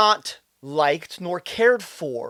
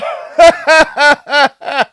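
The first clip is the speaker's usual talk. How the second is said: loud, high mock laughter; 6 semitones higher, 10 dB louder than usual. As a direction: laugh loudly at his own catchphrase. Mocking the man's catchphrase.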